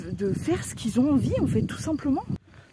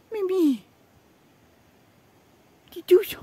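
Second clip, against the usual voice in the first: cooing at animals; 9 semitones higher, 3 dB louder than usual. Real, not acted.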